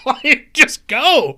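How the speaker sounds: slow, high, loud laughing plea; laughing exasperation. Real, not acted. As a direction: beg through a laugh. Urging heroine to just leave.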